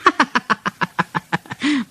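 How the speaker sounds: high-pitched, rapid giggle; cheeky staccato tittering; chuckle. A narrator's reading. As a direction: chuckle away in a little high character voice. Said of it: "Thomas chuckled".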